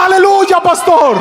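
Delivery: high cheering cry; excited shout. Acted. The boys cheering the pastor from the car, excited shout. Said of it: "Los muchachos gritan".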